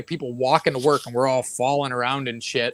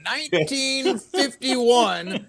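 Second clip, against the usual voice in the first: lightly voiced cowboy, pitched up and drawn out; a hint of higher, slow cowboy; foul-mouthed cowboy; 6 semitones higher, slower than usual. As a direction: imitate a crude old storytelling cowboy, slowly. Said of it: "foul-mouthed cowboy".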